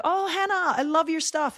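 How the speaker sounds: high-pitched gushing mock star; gushing star reply. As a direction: play the star delighted to meet a fan. Voices imagined reply from star.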